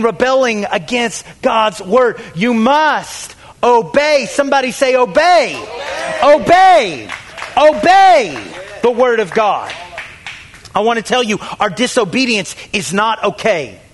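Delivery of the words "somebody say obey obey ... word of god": loud, raised call to repeat; rallying call. Real, not acted. Preacher urging the congregation to say it, rallying call. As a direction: punch it out for the congregation to echo.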